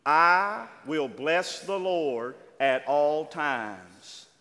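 very slow, loud, every word weighed; slow reading aloud. Shown as a demonstration. Preacher leads the church in reading the psalm, slow and loud. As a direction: lead the congregation through the verse word by word. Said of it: "I'm gonna read it slow".

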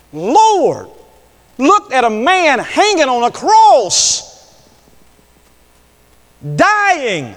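high, loud cries with long pauses; full-force preaching. Real, not acted. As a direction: hurl each word out separately, rising.

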